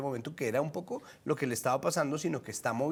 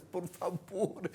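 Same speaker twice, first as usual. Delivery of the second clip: soft plea; 4 semitones higher, 7 dB quieter, much slower than usual. Real, not acted. Pleading aside in a monologue.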